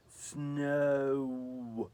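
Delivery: snow drawn out slowly over two beats; slow two syllables. Acted. Angel spelling out Snow slowly for Sylvie. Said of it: "slowly giving the word two syllables".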